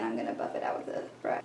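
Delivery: quick unvoiced whisper to herself; soft fast whisper, as if only to herself; whispered aside. Real, not acted. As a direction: murmur the step under your breath, fast. Restates the step, then whispers it.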